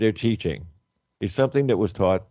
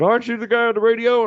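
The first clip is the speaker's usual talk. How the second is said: excited recognition; 13 semitones higher, 5 dB louder, faster than usual. Retold or imitated. Stranger recognizes him at diner.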